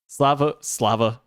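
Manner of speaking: put-on Russian accent. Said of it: "Slava is what they call Strava in, um, former Soviet countries".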